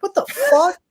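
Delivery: high, incredulous yelp of disbelief; baffled Aussie. Retold or imitated. Aussies reacting to foreigners praising Foster's, shocked high squeak. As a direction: squeak it out in total confusion. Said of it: "And we're like".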